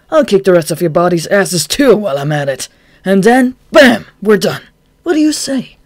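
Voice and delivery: loud, high brash challenge; cocky taunt. Acted. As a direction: taunt the gunman cheerfully. Chuya taunting the gunman.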